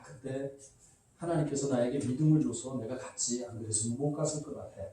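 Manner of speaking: low, soft fatherly storytelling voice; lightly voiced old father, musing; a hint of old Abraham. Father Abraham tells Isaac his journey, low and soft.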